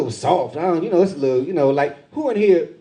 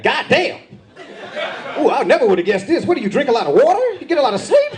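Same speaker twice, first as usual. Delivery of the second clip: amazed clerk; 8 semitones higher than usual. Retold or imitated.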